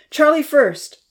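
high, loud command; urgent order. Acted. Uncle Louis yelling orders during the rescue, loud. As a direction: yell the order over the flood.

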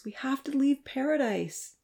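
soft, high plea to leave; begging. A narrator's reading. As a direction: plead with the deer to come. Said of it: "she begged the frightened animals".

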